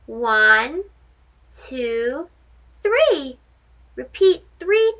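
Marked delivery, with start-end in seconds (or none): none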